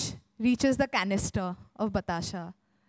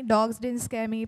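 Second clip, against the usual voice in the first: usual speaking voice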